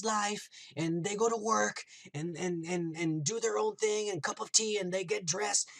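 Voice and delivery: high-pitched, pleading protest of innocence; playing the victim. Wanda tries to convince Vision she is innocent, playing the victim. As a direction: act out a wronged, incredulous wife. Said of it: "she tries to play the victim".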